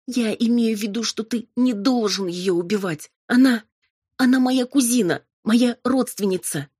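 high, loud, urgent pleading; desperate plea. A narrator's reading. Robin pleading for her cousin's life, desperate.

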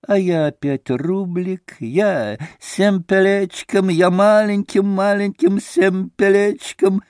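high, slow, gleeful little voice; blissful babbling. A narrator's reading. Maximov stakes tiny after winning, blissful high babble. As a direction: babble happily in a high put-on voice. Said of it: "блаженно бормотал Максимов".

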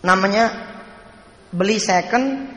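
ordinary talk, nothing marked

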